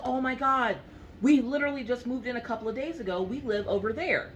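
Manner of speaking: high, fast gushing mom; excited neighbour mom. Retold or imitated. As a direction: gush about moving in fast.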